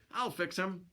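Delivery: high-voiced, mildly disgusted sidekick; a touch of scorn, pitched high. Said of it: "said Bill disgustedly".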